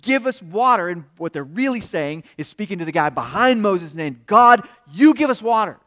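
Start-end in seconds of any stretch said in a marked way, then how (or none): 0.0-0.9 loud, high crowd complaint; acting out an aggrieved mob; angry accusation. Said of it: "they're gonna get at Moses and say". 4.3-5.8 very loud, high-pitched demand; acted-out defiant shout; angry demand. Acting people's demand aimed at God.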